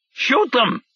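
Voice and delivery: high, frantic yelled order; a handler panicking in the ear. A narrator's reading. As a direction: hysterical shouted command. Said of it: "the handler said frantically".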